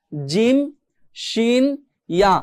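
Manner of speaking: জিম, শিন, ইয়া with mid-tongue on palate; touch mid-tongue to palate for জিম, শিন, ইয়া; demo letters. Shown as a demonstration. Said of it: "জিহ্বার মাঝখানটা কিন্তু উপরে তালুর সাথে লাগতেছে". (জিহ্বার মাঝখান তালুতে লাগিয়ে জিম শিন ইয়া)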